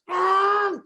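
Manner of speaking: loud high engine noise; imitated car roar. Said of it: "you hear this".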